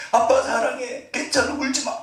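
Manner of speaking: high-pitched imitation of a crying son; mock tearful child. Son running to comfort his failed dad, mock crying. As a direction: comfort dad through pretend sobs. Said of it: "막 펑펑 울면서".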